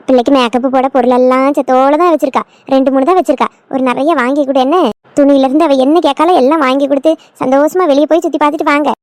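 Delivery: put-on older woman's low, coaxing voice; doting mother-in-law. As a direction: instruct the young couple with indulgent authority. Mother-in-law sends couple out.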